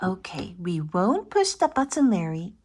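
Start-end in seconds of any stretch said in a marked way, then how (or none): none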